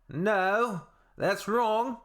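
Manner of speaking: high, emphatic put-on no; mock indignant correction. Correcting a comment that says there are four countries, mock indignant. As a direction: squeak out an exaggerated correction.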